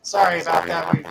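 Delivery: glitchy robotic mic distortion. Real, not acted. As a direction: sound like a broken robot mic. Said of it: "You sound like a robot".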